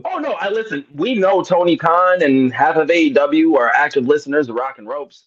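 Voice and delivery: loud, high playful bragging; excited boast. Real, not acted. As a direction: brag it out loud. Bragging wrestlers listen in.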